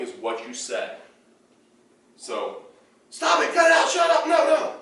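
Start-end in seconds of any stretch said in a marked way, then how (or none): 3.1-4.7 much higher, louder snapping commands; loud sharp no; sharp correction. Shown as a demonstration. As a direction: shout a correction at the dog. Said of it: "Correction as demonstrated with the word no".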